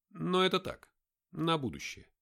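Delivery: lightly voiced brisk guide; a hint of a commanding leader; character voice. Nick voiced higher.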